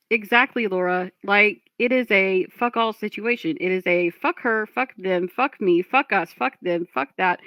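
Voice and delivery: ordinary talk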